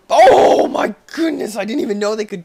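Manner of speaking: loud high astonished cry; amazed. Real, not acted. Amazed at game.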